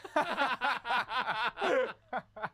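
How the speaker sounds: high drawn-out laugh; long laugh. Real, not acted. Host cracking up at the Ray Romano reveal, long high laugh. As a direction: lose it at the punchline.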